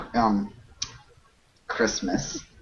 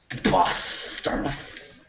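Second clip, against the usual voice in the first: slow, higher, hammed-up British "Preposterous"; drawn-out British voice; 7 semitones higher, slower than usual. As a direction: savour "Preposterous" slowly in mock British. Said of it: "best word to say in that voice".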